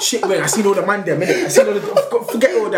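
words broken by giggles; can't stop laughing while talking. Real, not acted.

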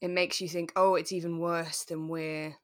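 normal talking voice

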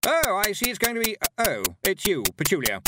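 high, gushing start sinking to a let-down; slightly disappointed. A narrator's reading. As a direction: begin brightly, then deflate on seeing who it is. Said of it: "slightly disappointed".

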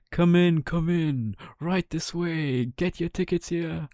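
loud, high barker's call. Acted. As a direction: draw the crowd in. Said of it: "He called out".

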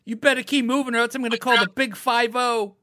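high, loud shouting guard; angry guard yelling. Retold or imitated. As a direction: warn them off, shouting. Mall guard yelling at kids.

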